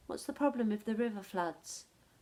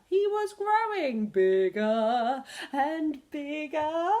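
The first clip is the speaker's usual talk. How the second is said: higher, louder and slow, swelling; growing excitement; 5 semitones higher, 8 dB louder, slower than usual. A narrator's reading. Teacher reading to children, building up slow and loud. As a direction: stretch it out as he grows.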